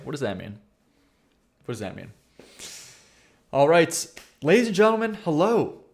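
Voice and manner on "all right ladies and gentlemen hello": loud, high-pitched host's welcome; big announced hello, easing toward normal; video intro. Acted. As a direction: greet the audience like a showman opening a video.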